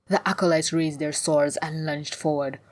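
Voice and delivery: ordinary delivery, nothing marked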